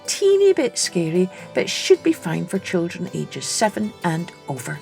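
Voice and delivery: ordinary delivery, nothing marked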